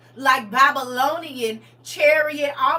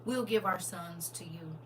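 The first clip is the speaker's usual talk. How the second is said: ordinary talk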